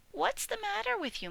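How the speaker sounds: high little piglet voice; bright squeaky young pig. Acted. Piglet answering the old sheep, high piglet voice.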